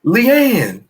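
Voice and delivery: high, drawn-out nickname holler; retold sideline yell. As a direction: re-enact calling a player from the sideline. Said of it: "trying to get her attention".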